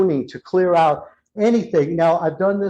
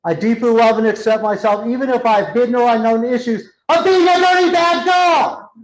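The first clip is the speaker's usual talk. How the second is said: higher, louder, a freed shout; shouting it out by the end; 6 semitones higher, 5 dB louder than usual. Retold or imitated. Client finally shouting her affirmation to the healer, loud. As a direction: belt the affirmation out in triumph. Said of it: "By the end of it".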